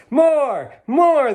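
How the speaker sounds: high, loud happy cries; delighted shoppers calling out, high and loud. A narrator's reading. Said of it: "the surprised but delighted shoppers called out".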